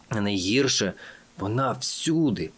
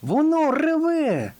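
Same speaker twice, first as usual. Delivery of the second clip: very high, drawn-out cry; alarmed exclamation; 4 dB louder, slower than usual. A narrator's reading. Fox warning his friends of the roar, exclaiming. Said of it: "вигукнув лис".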